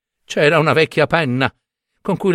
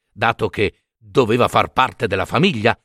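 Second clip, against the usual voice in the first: usual speaking voice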